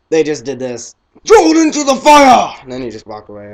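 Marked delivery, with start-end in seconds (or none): 1.2-2.5 high loud hammed-up yell; mock shout. Kid acting out the movie scene, loud mock yell. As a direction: belt it like a dramatic wizard. Repeats the quote, louder.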